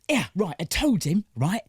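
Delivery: high-pitched attempt at an East End accent; bad East End accent. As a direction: try a Cockney geezer and miss. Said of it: "I just get high pitched".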